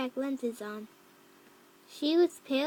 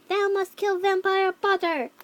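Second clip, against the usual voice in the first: loud, raised villain's order; yelled command; 5 semitones higher, 6 dB louder than usual. A narrator's reading. Voldemort ordering Ebony to kill, yelled command. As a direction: command it like an evil overlord. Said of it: "he yelled".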